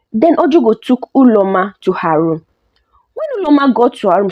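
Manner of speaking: ordinary delivery, nothing marked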